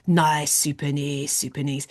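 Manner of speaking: low, slow Scottish approval; Scottish accent. Retold or imitated. Family quoting the gym guy's praise, low Scottish accent. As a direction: savour it in mock Scottish, low. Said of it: "You have to say it in Scottish accent".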